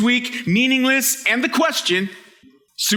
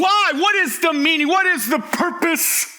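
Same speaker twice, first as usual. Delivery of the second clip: high, impassioned questioning cry; coming on strong; 6 semitones higher than usual. Acted. Preacher voicing someone questioning life's purpose, coming on strong. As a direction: fire the questions out with mounting desperation for an answer. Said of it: "He's coming on strong".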